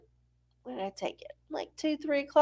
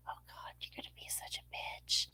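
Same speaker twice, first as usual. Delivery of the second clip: much softer, fast hushed grumble; whispered aside; 21 dB quieter, faster than usual. Pretend. Grumbling quietly about Karen the eight ball. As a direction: whisper it under your breath. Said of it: "aside muttered about Karen".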